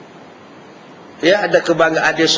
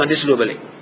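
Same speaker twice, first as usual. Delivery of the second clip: ordinary talk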